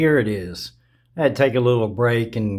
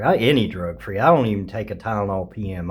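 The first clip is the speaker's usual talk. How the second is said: ordinary delivery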